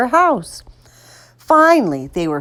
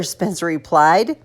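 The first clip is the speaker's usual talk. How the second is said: plain reading voice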